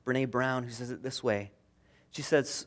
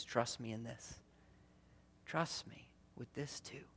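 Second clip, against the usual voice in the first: soft invitation; 2 semitones lower, 15 dB quieter than usual. Retold or imitated. Jesus voiced softly.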